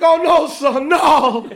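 high, loud, laughing "oh no son"; mock-horrified father caught out; hammed-up dad. Father reacting in movie retelling.

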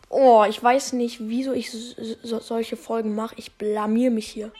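ordinary talk, nothing marked

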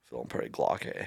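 low, soft imitation of a video guy; quoting a video in a low murmur. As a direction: mimic the meme guy, dropped low and quiet. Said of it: "that guy that's like".

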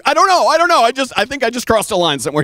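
high, loud, rushed babble; flustered panic. Retold or imitated. Reacting to the shooting star after praying, comically panicked. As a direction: backpedal in comic alarm.

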